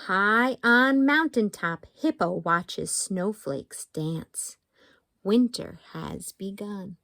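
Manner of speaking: slow, lilting haiku reading; picture-book poetry voice; reading poems to kids. Acted. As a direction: read each haiku playfully to little ones.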